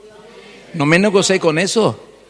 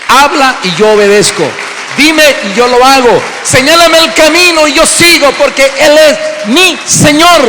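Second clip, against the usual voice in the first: shouted, much higher and louder, climbing declaration; preaching at full force; 8 semitones higher, 9 dB louder than usual. Acted. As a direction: proclaim it with rising fervour, slowing to a shout at the end. (predicador proclamando a gritos, con fervor creciente)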